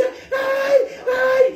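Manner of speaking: high, wailing mock scream; hammed-up shrieks of a horrified nun; nun's scream. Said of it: "empieza a gritar".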